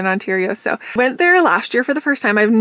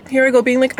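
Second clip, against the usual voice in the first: ordinary delivery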